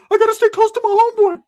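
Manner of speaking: high squeaky falsetto; hammed-up squeaky sidekick; Ricky's voice. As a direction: pitch way up into a goofy falsetto. Ricky voiced in retold story.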